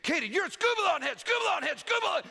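put-on childish yelling. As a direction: yell the insult over and over like a little kid. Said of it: "She started yelling at Katie".

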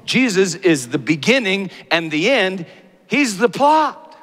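high, loud impassioned preaching; preaching at full force. Acted. Preacher building to a climax before the congregation, impassioned. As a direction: lift the room with a soaring sermon voice.

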